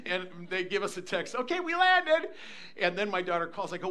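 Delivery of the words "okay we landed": high, loud singsong news; mock announcement. Daughter texts that they landed, high loud announcement. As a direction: act out a cheery text.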